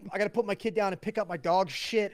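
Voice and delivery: loud, high, mock-apologetic rant; retold exasperated challenge. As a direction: re-enact an absurd challenge, worked up. Retold sarcastic challenge to dad.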